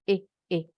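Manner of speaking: eh, eh as two brief separate vowels; short I sound. Shown as a demonstration. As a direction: show eh as a short lax vowel, twice. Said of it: "I pendek tuh". (bunyi I pendek, eh, eh)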